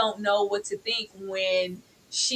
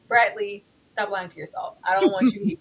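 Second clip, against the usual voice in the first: ordinary delivery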